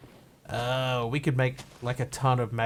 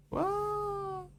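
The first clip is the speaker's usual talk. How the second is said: long, high, soft "whoa"; a drawn-out falling-through-air whoo, held high; 6 dB quieter than usual. Real, not acted. Reacting to a big fall.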